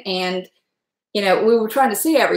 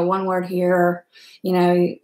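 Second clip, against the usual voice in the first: ordinary delivery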